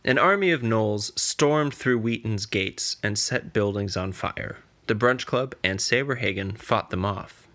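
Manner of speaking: plain reading voice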